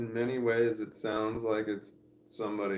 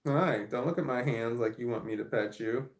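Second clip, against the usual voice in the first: ordinary delivery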